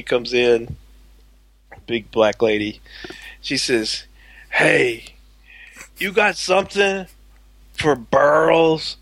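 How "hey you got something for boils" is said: cartoonish Fat Albert impression of a customer; retelling a pharmacy customer as Fat Albert.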